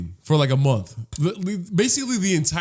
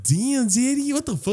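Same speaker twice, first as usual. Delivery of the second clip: higher, teasing mock surprise; mock impressed onlooker; 9 semitones higher than usual. Onlooker teasing a big spender at McDonald's. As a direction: tease like an impressed stranger. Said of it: "then be like".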